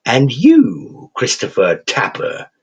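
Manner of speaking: put-on slow, crooning English accent, gleefully cheerful; a cheerful English creature savouring every word. Raven creature chiding the thug in a cheerful English accent. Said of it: "in a cheerful, slightly English accent".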